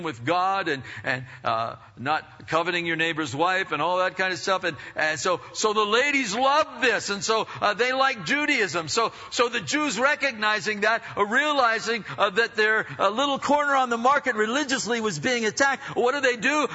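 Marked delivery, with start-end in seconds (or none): 5.5-16.7 high-pitched pulpit storytelling; impassioned preaching. Real, not acted. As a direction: drive the story on with preacher's fervour.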